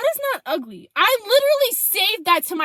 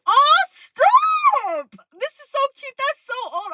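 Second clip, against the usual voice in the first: high-pitched squealed gushing, loud at first; mock gushing; 10 semitones higher, 8 dB louder, slower than usual. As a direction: play up being flattered, squealing high. Acts out gushing reply to picture.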